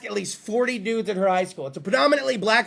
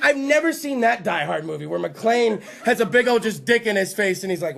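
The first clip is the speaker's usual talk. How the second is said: ordinary talk, nothing marked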